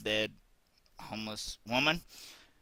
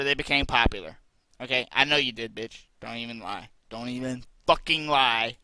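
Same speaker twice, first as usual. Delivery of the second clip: angry rant; 8 dB louder than usual. Real, not acted. Ranting insults at Anita.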